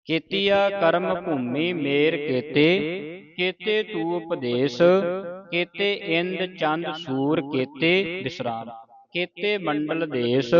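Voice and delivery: slow chanted recitation, pausing after the first ਕੇਤੇ; correct pauses. Shown as a demonstration. Teacher recites Gurbani to students with correct pauses. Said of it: "ਪਹਿਲੇ ਕੇਤੇ ਤੇ ਵਿਸਰਾਮ ਲਾਉਣਾ ਹੈ".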